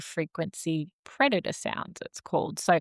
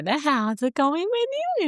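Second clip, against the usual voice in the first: high, lilting voice for a little daughter; playful parent sing-song; sing-songy baby talk; 9 semitones higher than usual. Retold or imitated. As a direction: coo at your daughter. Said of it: "that sing-songy voice".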